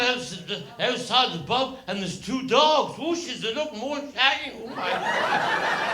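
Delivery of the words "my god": squeaky laugh. Real, not acted. Aghast at the cows, dogs.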